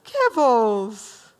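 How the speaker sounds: high, loud cooing over a puppy. Retold or imitated. Teacher imitating dog lovers cooing at Kibbles. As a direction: gush at the dog like a baby. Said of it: "some of you said".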